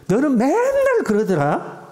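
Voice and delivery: slow, high mock accusation; blaming. Shown as a demonstration. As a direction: needle them with the blame. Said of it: "비난하는 거죠".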